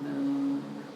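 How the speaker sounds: usual speaking voice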